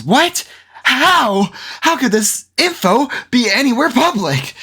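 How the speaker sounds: high, overblown "how could this be" gasp; overdramatic shock. Retold or imitated. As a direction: ham up the developer's disbelief. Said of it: "very overdramatic".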